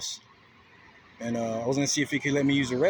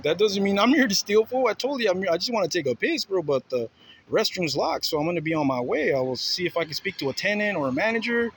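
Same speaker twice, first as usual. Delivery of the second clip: loud, higher indignant retelling; heated retold argument; 5 semitones higher, 5 dB louder than usual. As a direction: push back hard, raised voice.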